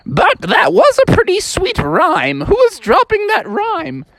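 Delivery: Mother Frog voice. Retold or imitated. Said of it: "Froggy Froggerson's mom did say".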